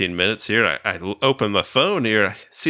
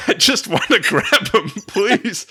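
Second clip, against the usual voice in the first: high, laughing begging; laughing plea; 10 semitones higher than usual. Real, not acted. Pleading with the ticket lady while laughing, higher voice. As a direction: beg through laughter.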